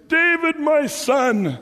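high, drawn-out kingly call; hammed-up cry to a son; acted calling out. Saul calling out to David.

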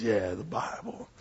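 put-on very low, quiet old-time sermon opening; imitated preacher's low rumble; grumbling preacher. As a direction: grumble it barely intelligibly. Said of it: "he kinda grumbles".

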